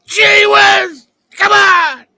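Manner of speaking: high, loud sports-radio yell; ranting radio host. Retold or imitated. Mocking a sports radio host, loud shouting. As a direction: scream like a furious radio caller host.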